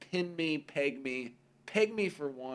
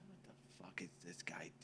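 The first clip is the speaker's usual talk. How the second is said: low, soft baffled mutter; 7 semitones lower, 21 dB quieter than usual. Retold or imitated. Parents staring at their son, baffled murmur. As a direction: mutter in disbelief. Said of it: "both of them eventually would say".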